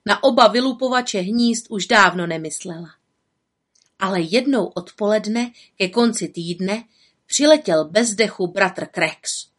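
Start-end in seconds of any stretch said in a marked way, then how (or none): none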